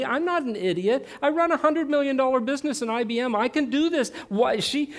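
high, indignant self-justifying rant, petering out; exasperated pleading. Retold or imitated. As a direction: plead your case like an aggrieved husband. Acts out his exasperated self-righteous prayer.

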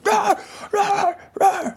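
high, yappy barks like a spooked dog; imitated dog barking. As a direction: bark it out like a frightened little dog. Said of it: "the dog's like".

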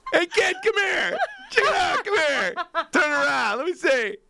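high, loud teasing while cracking up; hammed-up gawking through laughter; laughing mock tease. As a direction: crack up while calling the kid over. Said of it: "he'd been doing it for years".

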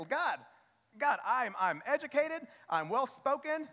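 high, loud boastful plea to God; hammed-up appeal, pitched well up; mock self-promotion. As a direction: act out someone pitching their own credentials. Voices a self-important believer pleading.

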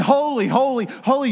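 high, loud, rapturous holy; joyful holy. Retold or imitated. Heavenly worshippers crying out, rapturous and loud. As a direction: proclaim it in delight. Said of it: "and, and".

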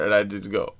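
ordinary talk, nothing marked

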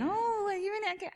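very high mimicked question; mock nosy reaction. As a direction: squeal it like a nosy acquaintance. Mimicking people reacting to her appearance.

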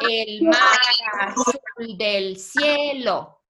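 "El mar azul del cielo" slowly, stretched syllable by syllable. Shown as a demonstration. Teacher showing students how many syllables, syllable by syllable. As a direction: measure out each syllable for counting. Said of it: "¿Cuántas sílabas aquí?".

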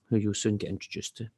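ordinary delivery, nothing marked

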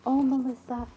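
sing-song reassurance, held high; gentle mom. Retold or imitated. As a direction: coax a demanding child along patiently. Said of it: "very gently and kindly says".

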